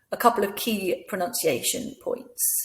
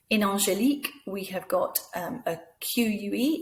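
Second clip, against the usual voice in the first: ordinary delivery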